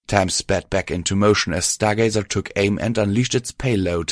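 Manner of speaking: plain reading voice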